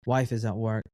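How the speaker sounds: normal talking voice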